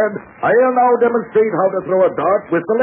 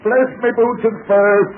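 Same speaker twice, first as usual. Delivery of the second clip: hearty exclamation; 6 semitones higher, slower than usual. Acted. Captain admires dart throwing.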